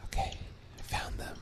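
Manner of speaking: hushed unvoiced whisper; whispering on the phone. Real, not acted. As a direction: whisper it so nobody hears. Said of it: "why are you whispering?".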